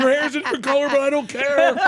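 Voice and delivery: high, loud burst of mock canine joy; overjoyed dog. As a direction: greet them like a frantic dog. Voicing overjoyed dog at reunion.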